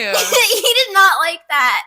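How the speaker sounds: laughing through the words, slow. Real, not acted. Woman laughing at her own pizza comeback. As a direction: giggle out the line.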